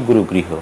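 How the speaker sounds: গুরুগৃহ run straight through; single g. Shown as a demonstration. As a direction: say গুরুগৃহ as "guru-griho". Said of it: "গুরুগৃহ হবে না".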